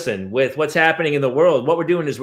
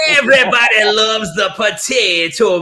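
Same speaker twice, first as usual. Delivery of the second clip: loud, slow put-on island accent; mock Jamaican accent; 3 semitones higher, 5 dB louder than usual. Comic declaring love of potato, loud mock Jamaican accent. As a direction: boom it out in a joking Jamaican lilt.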